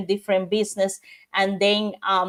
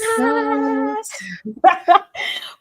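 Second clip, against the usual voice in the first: laughing; 6 semitones higher than usual. Real, not acted. Cheerful laugh at show sign-off.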